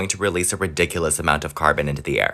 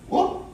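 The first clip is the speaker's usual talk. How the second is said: startled yelp; 6 dB quieter than usual. Real, not acted. Attempting backbend flip.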